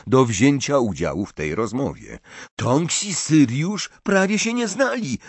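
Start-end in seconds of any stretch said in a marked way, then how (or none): 2.6-5.2 high-pitched, defensive objection; huffy protest. A narrator's reading. Ron arguing back at Hermione, bristling. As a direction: protest with a heated, raised pitch. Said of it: "zaperzył się Ron".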